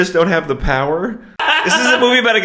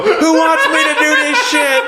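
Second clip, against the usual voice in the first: high, slow hammed-up taunting voice; a cocky hero soaking up the cheers; mock gloating hero; 10 semitones higher than usual. Mocking how he gloats to the crowd, hand at his ear.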